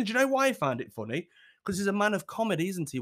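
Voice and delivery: normal talking voice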